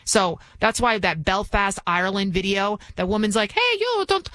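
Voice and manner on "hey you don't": high-pitched mock Irish call; attempted Irish accent. Host imitating a Belfast woman shouting at someone, attempted Irish accent. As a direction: call out in a put-on Irish lilt. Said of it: "I'm trying to do my Irish".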